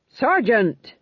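sharp reprimand. A narrator's reading. King cutting off his sergeant's arson idea, reprimanding. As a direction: interrupt with a firm call.

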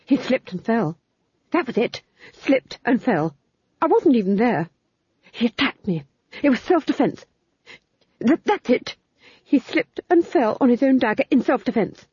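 a guilty lord babbling excuses; nervous duke. A narrator's reading. Duke denying the killing to the Fool, flustered.

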